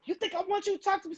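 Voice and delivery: high, fast mock outrage; quick, high-pitched imitation of a hostile man; mimicking his angry outburst. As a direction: snap it back like an offended stranger. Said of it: "So he just flipped out".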